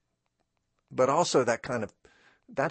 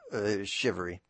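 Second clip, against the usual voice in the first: ordinary delivery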